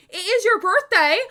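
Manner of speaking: high loud surprised exclamation; excited. Real, not acted. Realizing chatter's birthday.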